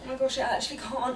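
flustered, straining into jeans; fast, stressed struggle; hopping into jeans. Real, not acted. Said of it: "flustered and stressed out".

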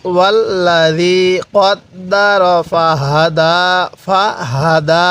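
ফাহাদা with a light breathy হা, slow. Shown as a demonstration. Teacher showing breathy ha in ফাহাদা, slow. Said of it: "মুখ দিয়ে হালকা আওয়াজ বের করব".

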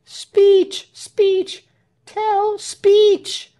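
very high chanting calls; crowd's cry. A narrator's reading. Crowd urging Tell to speak, crying out. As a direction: call out high and insistent. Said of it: "Everybody took up the cry".

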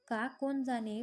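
plain reading voice

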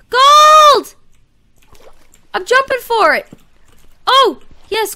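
a big high excited yell of Gold!; loud, high burst of joy; excited shout. Real, not acted. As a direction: shout it out, thrilled at the find. Spots gold while mining.

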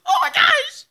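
high, loud outburst; laughing shriek. Real, not acted. Reacting to a crude joke in chat, laughing shriek. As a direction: shriek it, overcome with real laughter.

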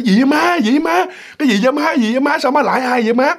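high, urgent imitated son; alarmed questioning. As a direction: fire off worried questions at mother. Son runs up alarmed to his mother.

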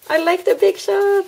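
high, loud and gleeful; delighted fan voice; gushing. Real, not acted. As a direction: squeal your love for the machine. Gushing about her tool.

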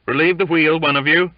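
high, loud bosun's shout; shouting an order. A narrator's reading. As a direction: call the order in to the crew. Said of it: "he shouted inside".

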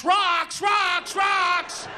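high, loud repeated warning blare; alarm shout. Acted. Mimics a flashing warning alarm.

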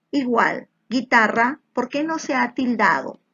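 normal talking voice

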